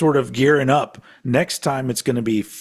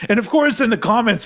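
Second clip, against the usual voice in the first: ordinary talk, nothing marked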